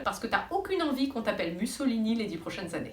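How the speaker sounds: ordinary talk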